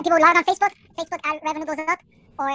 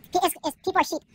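normal talking voice

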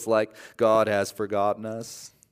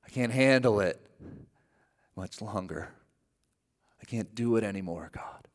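fading to a broken whisper; desperate prayer. Retold or imitated. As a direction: plead with God, ending in whisper. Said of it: "we just say".

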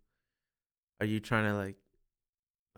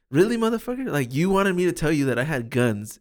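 loud, high-pitched disbelief; exasperated outburst; 6 semitones higher, 6 dB louder than usual. Retold or imitated. Retold reaction to cops releasing him, exasperated. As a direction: burst out incredulous, pitch jumping up.

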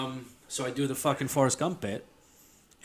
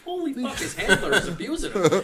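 shocked, laughing; 7 semitones higher than usual. Retold or imitated. Shocked reaction retold through laughter.

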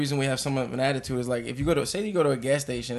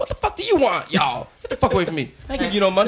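high-pitched, indignant New York brush-off; mock hostile rebuff; 6 semitones higher, 4 dB louder than usual. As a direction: act out chasing off a pushy stranger. Acts defensive New Yorker rebuffing stranger.

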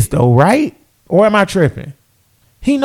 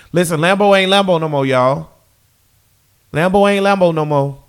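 ordinary delivery

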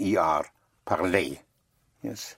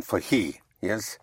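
usual speaking voice

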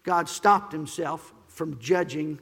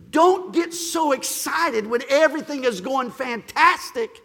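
high, loud preaching that builds to a shout; full-force preaching; 10 semitones higher, 12 dB louder than usual. Acted. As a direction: preach it out to the whole congregation.

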